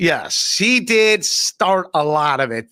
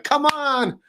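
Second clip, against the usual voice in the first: higher-pitched taunting callout, imitated; mock challenge; 6 semitones higher than usual. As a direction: imitate someone calling out a challenge. Acts out challenger outside someone's house.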